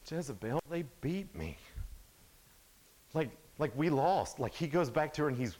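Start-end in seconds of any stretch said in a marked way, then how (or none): 0.1-4.4 mild whiny complaint, a bit higher; whining. Retold or imitated. Husband whining to his wife about losing. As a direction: whine it like a sulking loser. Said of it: "he's whining and he's complaining".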